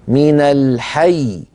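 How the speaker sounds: الحي said very slowly, ending on one stressed ya; pausal stress. Shown as a demonstration. Teacher demonstrates the stress on الحي when stopping. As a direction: press down on the ya of الحي at the stop. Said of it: "ما نستطيع أن ننطق إلا ياء واحدة".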